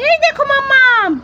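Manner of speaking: put-on high, cheeky little boy voice; child voice. Little son teasing his mother, in a high cheeky child voice. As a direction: play the teasing toddler answering mummy.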